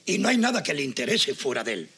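low, measured aside to the audience; confiding narration. Acted. Husband explains his partner to the viewers, confiding. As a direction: explain it drily with deliberate pauses.

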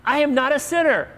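high, loud, mock-outraged five-year-old; indignant child. Preacher imitating his five-year-old daughter's denial, very indignant. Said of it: "Peyton was so indignant".

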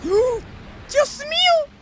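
very high, slow, drawn-out cry of alarm in Spanish; hammed-up shock. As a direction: stretch it out like a melodramatic gasp.

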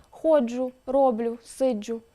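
"Ходжу, роблю, сиджу" each stressed on the first syllable; penultimate stress. Shown as a demonstration. Narrator demonstrating dialect verb stress, "Ходжу, роблю, сиджу" stressed on the next-to-last syllable. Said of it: "наголос зазвичай припадає на передостанній склад".

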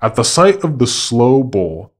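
ordinary delivery

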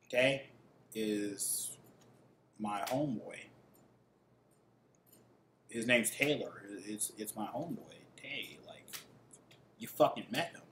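put-on quietly explaining to a jealous girlfriend it's his homeboy; hushed, deadpan reply. To his jealous ex, softly and flatly explaining that Tay is his homeboy.

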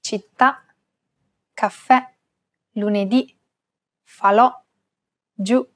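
Città, caffè, lunedì, falò, giù, each stressed on the last vowel; stress on final vowel. Shown as a demonstration. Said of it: "can have a grave accent".

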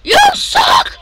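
high-pitched taunting shout; high, heated gamer yell; trash-talk shout. Real, not acted. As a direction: yell it at your opponent. Yelling at game opponent.

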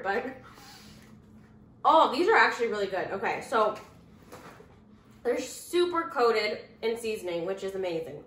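eating snack while speaking; talking while chewing. Real, not acted. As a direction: mouth partly full of food. Seen 0: Pretzel piece in hand; lips pressed, chewing motions between phrases.

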